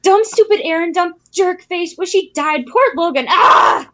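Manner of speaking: high, loud, frantic overreaction; impression of Mitch. Cohost imitating Mitch's finale reaction, high and loud. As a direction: squeal it out like a shocked fan. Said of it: "this is my attempt at Mitch".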